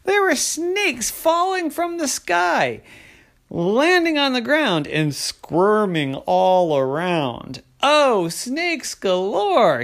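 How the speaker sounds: big wide-eyed narration, swooping high; dramatic storytelling. A narrator's reading. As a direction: stretch out the astonishing reveal. Narrator reveals snakes falling from sky.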